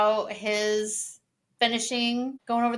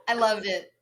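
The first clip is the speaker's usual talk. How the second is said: laughing. Real, not acted. Laughing right after the quote.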